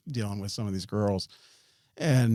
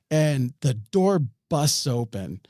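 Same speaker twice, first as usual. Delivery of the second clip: usual speaking voice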